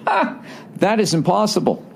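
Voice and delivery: high-pitched scoff of disbelief; disbelieving "ha", then a firm protest; incredulous retort. Retold or imitated. Traveler to counter agent on being bumped to a later flight, incredulous.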